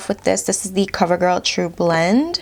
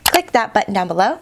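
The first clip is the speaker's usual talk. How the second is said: ordinary talk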